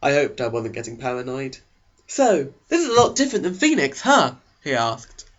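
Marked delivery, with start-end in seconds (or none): 2.1-4.4 put-on higher, louder eager teen boy voice; eager Eric. Eric leaning over to the new girl, eager and high. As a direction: pitch him up high and keen, a chess-club type. Eric makes small talk.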